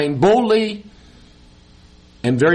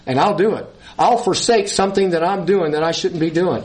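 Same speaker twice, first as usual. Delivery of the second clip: usual speaking voice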